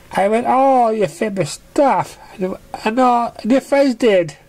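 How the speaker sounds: high pony voice tumbling over words; a fan gushing to her idol; high bubbly pony chatter; eager young pony. Acted.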